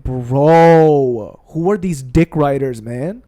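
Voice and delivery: loud, long drawn-out groaning word; exasperated. Acted. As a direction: stretch it out loudly in exasperation. Exasperated reply to quoted critics.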